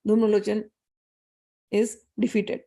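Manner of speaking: ordinary talk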